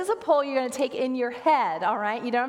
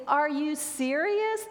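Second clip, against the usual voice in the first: slow, higher-pitched disbelief; incredulous; 4 semitones higher, slower than usual. Acted. Pastor talking back to Jesus about worry, incredulous. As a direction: draw it out in disbelief.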